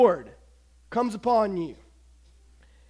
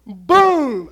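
loud, drawn-out impact cry; big home-run boom; explosive boom; 4 semitones higher, 11 dB louder than usual. Acted. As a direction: shout it like the bat crushing the ball. Imitates bat hitting a lob pitch.

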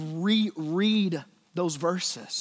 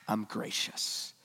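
whisper; 6 semitones lower, 10 dB quieter, slower than usual. Acted. Voicing God's gentle words.

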